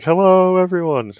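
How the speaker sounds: high, loud host greeting; big intro voice. Retold or imitated. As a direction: belt out a showman's hello. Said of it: "I thought you were gonna do, like".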